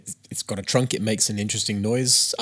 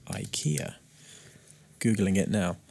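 whisper; 2 semitones lower, 9 dB quieter than usual. Real, not acted. Muttering while googling.

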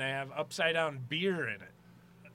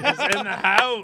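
loud, slow, higher hype call; hype announcement; 5 semitones higher, 12 dB louder, slower than usual. Acted. Host echoing the guest's entrance, loud and slow. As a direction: finish the ring-announcer intro.

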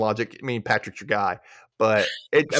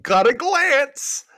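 high quote voice; 13 semitones higher, 5 dB louder, much slower than usual. Retold or imitated.

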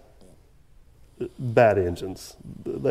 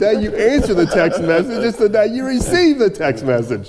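mock patient, higher pitched lecture; sarcastic explaining; 8 semitones higher than usual. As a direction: explain it like to a child. Mock-explaining day you answer text.

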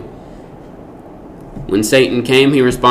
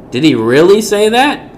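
much higher, sly doubting question; mock doubting; 7 semitones higher than usual. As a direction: plant the doubt like a tempter. Voicing Satan's doubting suggestion.

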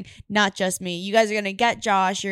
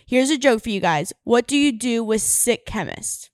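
ordinary talk, nothing marked

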